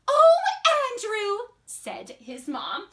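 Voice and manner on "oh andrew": high motherly "oh", swooping down; chiding mom. Acted. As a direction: fondly brush off your child's plea. Mom chiding son in story.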